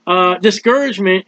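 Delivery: ordinary delivery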